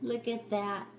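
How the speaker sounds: usual speaking voice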